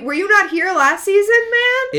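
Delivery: high, loud indignant protest; exasperated incredulity. Acted. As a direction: climb in pitch and volume in mock disbelief. Henry's incredulous retort to Hook, acted.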